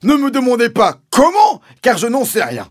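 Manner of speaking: very high, loud outburst; Mister Hazel exclaiming. A narrator's reading. Landowner snapping at the sergeant's question, high angry shout. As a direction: screech it in exasperation. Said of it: "s'est exclamé mister Hazel".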